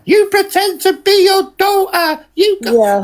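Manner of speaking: squeaky mimic of him; mocking high voice. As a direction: parody him in a high whiny pitch. Mocking his accusation.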